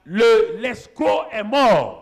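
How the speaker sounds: loud, rising preacher's cry; preaching at full force. Acted. Pastor thundering at the congregation about stealing. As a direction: drive the warning home to the church.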